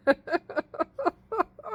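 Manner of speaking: high burst of laughter. Real, not acted. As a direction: laugh out loud at the memory. Laughs at retold regret remark.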